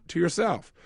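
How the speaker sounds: ordinary delivery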